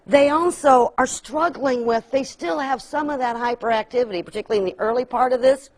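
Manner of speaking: usual speaking voice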